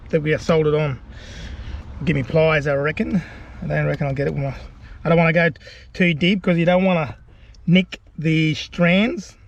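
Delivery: ordinary talk, nothing marked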